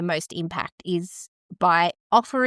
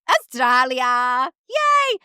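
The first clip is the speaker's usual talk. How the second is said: celebrating; 3 semitones higher, much slower than usual. Real, not acted. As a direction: stretch out the name, then squeal with glee. Celebrating top listening country.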